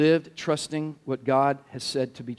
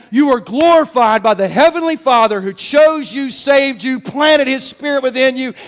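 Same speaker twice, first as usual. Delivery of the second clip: raised, insistent sermon delivery; full-force preaching; 8 semitones higher, 5 dB louder than usual. Real, not acted. Preacher telling the congregation what glorifies them, insistent preaching. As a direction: drive each repeated point home.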